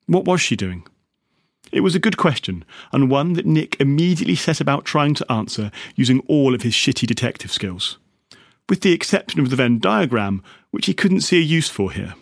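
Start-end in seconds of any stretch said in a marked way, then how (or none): none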